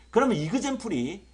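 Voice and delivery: ordinary delivery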